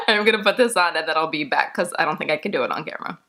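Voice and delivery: ordinary talk, nothing marked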